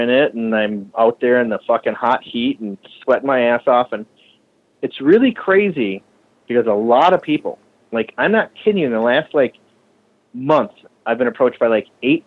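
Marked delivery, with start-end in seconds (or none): none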